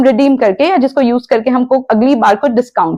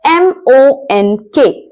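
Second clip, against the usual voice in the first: letter by letter, spaced and slow; slowly spelt letters; slow spelling; 2 semitones higher, much slower than usual. Shown as a demonstration. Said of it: "spelling the word".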